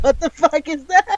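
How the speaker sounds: high, incredulous what-the; mock shocked squeak; baffled reaction. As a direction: squeak out total disbelief. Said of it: "And it's like".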